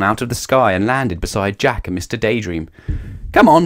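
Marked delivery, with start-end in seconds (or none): 3.3-3.7 higher, louder call to come along; mildly raised call; eager call. A narrator's reading. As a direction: call him onward brightly. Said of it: "said Mister Daydream to Jack".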